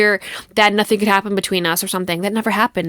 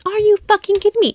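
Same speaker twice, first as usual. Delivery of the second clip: much higher, fast, incredulous yell; outraged disbelief; 11 semitones higher, faster than usual. Retold or imitated. Recounting his lie to her podcast listeners, outraged high disbelief. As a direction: fire it back, can't believe what you heard.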